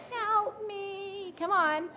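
high, shrieking wicked witch. Retold or imitated. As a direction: cry out like the dying witch. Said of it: "do you remember what the witch said".

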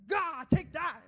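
high, very loud attacking cry; mock battle yell. Preacher as Peter striking at the arrest, comic battle yell. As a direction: yell it like a wild sword swing.

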